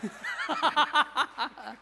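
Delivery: short burst of laughter. Real, not acted. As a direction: laugh out in quick pulses. Laughs after his own joke.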